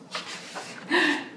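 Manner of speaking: sharp breathy gasp of alarm; dramatic gasp. Acted. Reacting to the lost dog for the kids, a big gasp.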